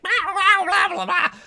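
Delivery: very high, loud little-critter squawking; freaking-out creature. Acted. Game master as the creature landed on the ground, frantic squawks. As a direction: squeal nonsense like a panicking small creature. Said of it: "lands on the ground and is like".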